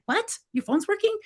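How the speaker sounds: high, soft incredulous question; stunned, can't believe it; disbelief. Retold or imitated. Neighbors' phone works, hers doesn't.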